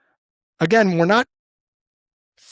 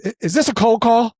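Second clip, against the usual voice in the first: higher, louder irritated demand; mock-angry CTO barking down the phone; 6 semitones higher, 4 dB louder than usual. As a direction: snap at a cold caller. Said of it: "Like, in an angry voice".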